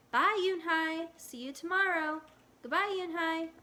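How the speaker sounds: high, loud happy farewells; cheerful goodbye. Acted. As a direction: call out goodbye brightly. Friends calling goodbye.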